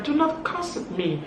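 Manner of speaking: high-pitched, insistent objection; gracious but firm. Retold or imitated. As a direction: imitate someone firmly refusing to be cursed. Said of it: "she was very gracious".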